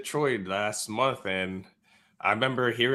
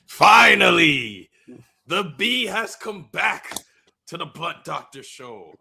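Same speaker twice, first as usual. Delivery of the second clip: grand entrance announcement; 6 dB louder than usual. Pretend. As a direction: announce your own return like a showman. Guest's grand entrance after being introduced.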